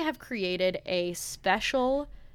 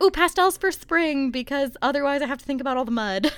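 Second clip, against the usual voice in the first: bright, louder, higher mock enthusiasm; hammed-up forced cheer about pastels; mock cheery voice; 6 semitones higher, 6 dB louder than usual. Mocking spring lovers with forced cheer, louder and higher. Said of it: "It's like".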